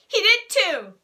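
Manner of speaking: a hint of a bratty little girl complaining; tattling girl Sheila. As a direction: tell on him to the grown-up. Sheila argues back.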